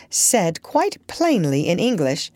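usual speaking voice

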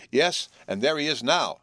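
excited shout, voice up. Acted. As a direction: call it out, pointing. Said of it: "shouted Will".

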